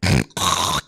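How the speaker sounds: gross low throat hock. Shown as a demonstration. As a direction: hock up phlegm loudly. Mimics hocking phlegm.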